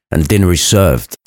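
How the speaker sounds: usual speaking voice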